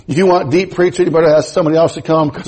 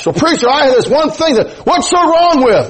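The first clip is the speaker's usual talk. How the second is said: much higher, pleading protest; protesting objection; 10 semitones higher than usual. Acted. Believer defends a pet sin to the preacher, high protesting. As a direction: push the pitch up in mock protest.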